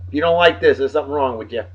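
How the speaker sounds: ordinary delivery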